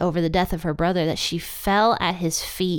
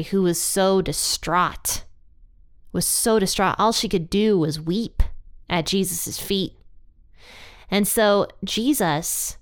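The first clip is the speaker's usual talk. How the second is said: ordinary talk, nothing marked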